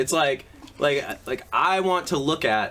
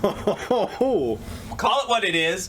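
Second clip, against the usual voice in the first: teasing; 9 semitones higher, much slower than usual. Real, not acted. Teasing retort.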